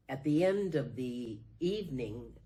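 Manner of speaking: ordinary talk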